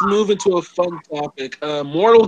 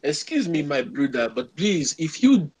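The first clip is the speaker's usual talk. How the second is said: put-on African accent, over-polite; comic African-accented plea; 2 semitones lower than usual. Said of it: "the way I learned African accents growing up".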